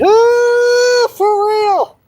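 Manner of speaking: high, loud mock gasp of disbelief; mock shock. Reacting to own joke, mock shock. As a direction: act scandalised at the joke.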